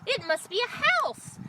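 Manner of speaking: high squawks, then high excited speech; excited rooster. A narrator's reading. Rooster spots a light from the treetop, excited squawking. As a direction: crow the discovery out from the treetop. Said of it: "and he said".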